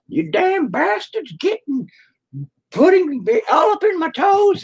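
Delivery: put-on very high, slow creaky old man voice; senile old man. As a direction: rant like a confused old geezer. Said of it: "it'll be great".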